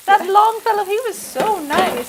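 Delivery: put-on high, slow Swedish-accented mom. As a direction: imitate a Swedish mother's accent. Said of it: "because of her Swedish accent".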